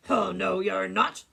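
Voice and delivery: loud, forceful troll refusal. Acted. Troll refuses to let the goat pass, loud and menacing. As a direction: boom it like a bullying troll. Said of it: "said the troll".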